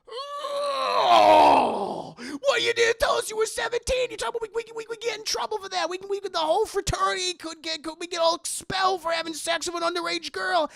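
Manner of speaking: very high, loud wailing panic; panicked wail. Retold or imitated. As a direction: wail at her as if ruined. Jonathan panics at girl.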